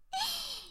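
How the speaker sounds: very quiet high giggle; a little breathy laugh of anticipation; soft giggle. Acted. Big sister giggling as the tickling game starts, quiet high laugh.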